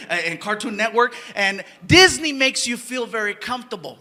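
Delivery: usual speaking voice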